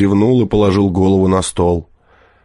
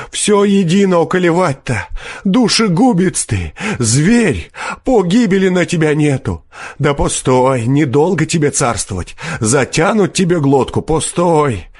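high-pitched raging curses; fierce threats; 9 semitones higher than usual. A narrator's reading. Wretched peasant cursing forester, fierce threats. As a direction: threaten him as one already doomed. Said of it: "подхватил мужик свирепым голосом".

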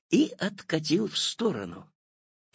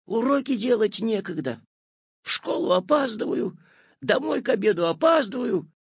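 put-on boy's complaining voice; 8 semitones higher, 4 dB louder than usual. A narrator's reading. Boy complaining about no time.

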